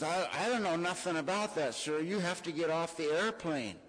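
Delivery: a fed-up stewardess insisting; impatient flight attendant. Retold or imitated.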